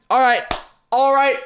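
loud, high hype yell; high, punchy rallying cry; psyched-up shout. Real, not acted. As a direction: shout it high, pumping yourself up. Psyching up before opening case.

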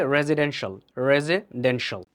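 রেসিডেন্সিয়াল with a z, then broken into "re-ze / den-shal"; correct pronunciation. Shown as a demonstration. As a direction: model রেসিডেন্সিয়াল as "rezidenshal", repeated carefully in two parts. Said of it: "So, এটা হবে".

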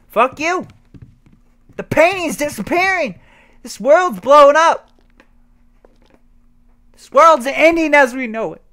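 loud high panicked cries; frantic shouting. Real, not acted. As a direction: yell in wild alarm.

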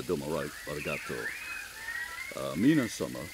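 a hint of a visiting Japanese industrialist addressing the room; Japanese businessman accent. Shown as a demonstration. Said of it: "a quick example of the, of the accent you use".

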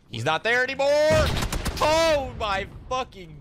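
high loud frustrated outburst; frustrated yell. Real, not acted. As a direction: blurt it out in disbelief.

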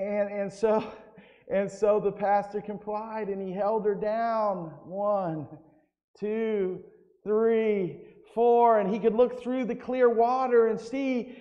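ordinary delivery

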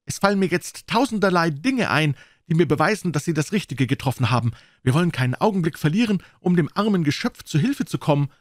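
high, eager woman's voice; young governess, excited and keen. A narrator's reading. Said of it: "rief Fräulein Hunter aus".

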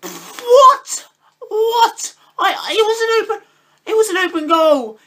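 very high, loud, laughing cry of disbelief; real pained high outcry; anguished disbelief. Real, not acted. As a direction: wail at the missed open goal. Striker misses open goal.

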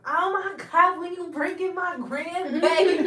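high, loud gushing grandma voice; mock excited grandma. Teasing how families act once the baby arrives, loud high mock gush. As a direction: imitate a grandparent squealing for the baby. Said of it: "And then when the baby come".

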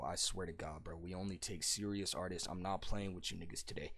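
very quiet, low, disappointed verdict; hushed, serious scolding; stern rejection. Real, not acted. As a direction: lay down the rules low and softly. Stern rejection after bad submission.

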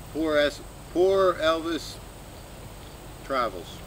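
ordinary talk

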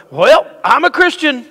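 high, loud, drawn-out proclamation; hammed-up declaring to all the world; mock announcement. Believer walking out to tell people, mock loud announcement. Mimics someone brashly announcing their faith.

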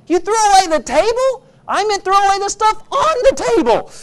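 high, loud, acted exasperation; upset wife. Wife tells husband she meant only the stuff, acted outrage. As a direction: wail it in high-pitched, acted disbelief.

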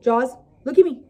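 ordinary talk

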